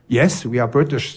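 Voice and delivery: ordinary delivery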